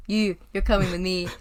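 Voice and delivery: put-on loud, rough Scottish accent as Shrek; terrible Scottish accent. Host retries Shrek's line, weak Scottish accent. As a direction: another go at a bad Scottish growl. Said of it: "That's a terrible Scottish accent".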